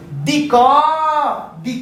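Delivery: दिक stretched into "di-kaw", long open final vowel; teacher stretching the final क of दिक into "kawww"; drawn-out pronunciation. Shown as a demonstration. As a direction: sound out दिक slowly, holding the end. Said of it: "इसका उच्चारण करने के लिए".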